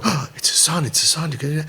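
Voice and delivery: hushed gasp of realization, lightly acted; mock astonished murmur; murmuring onlookers. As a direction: imitate a crowd buzzing with the discovery. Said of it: "everybody's gonna start going".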